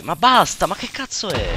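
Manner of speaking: high loud shocked outburst; startled shout. Real, not acted. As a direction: yell in sudden alarm. Sudden mob in cave.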